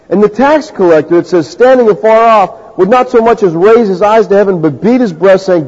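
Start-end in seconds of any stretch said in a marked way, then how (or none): none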